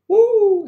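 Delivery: high, loud wrestler's woo; Ric Flair whoop. Acted. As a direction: let out a long showman's whoop. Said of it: "Ric Flair".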